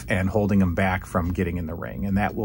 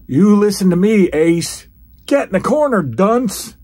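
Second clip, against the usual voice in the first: loud, much higher mock tough-guy order; Orndorff impression; 9 semitones higher, 8 dB louder than usual. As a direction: bark the order like a bully wrestler. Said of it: "No, he goes".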